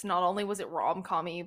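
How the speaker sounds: ordinary talk, nothing marked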